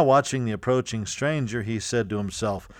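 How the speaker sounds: plain reading voice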